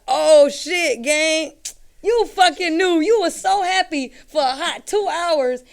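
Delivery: loud, high-pitched acted outburst; mock outrage. Barber mocking a client who forgot to pay, loudly outraged. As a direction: call out the faker at full volume. Mocks forgetful client, then retorts.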